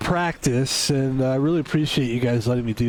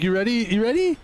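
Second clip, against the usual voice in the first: eager hype; 7 semitones higher, faster than usual. Retold or imitated. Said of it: "I was like".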